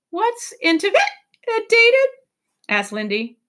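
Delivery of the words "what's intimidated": high little voice, hiccup mid-word; child's question. A narrator's reading. Lindy asking the professor, high and childlike. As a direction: ask it as a puzzled child.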